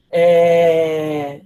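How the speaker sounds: glitchy breaking-up audio; corrupted glitchy audio. Real, not acted. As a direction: voice cutting out on a bad connection. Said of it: "o áudio tá falhando".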